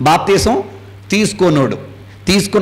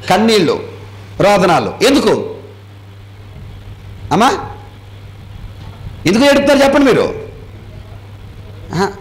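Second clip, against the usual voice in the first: ordinary delivery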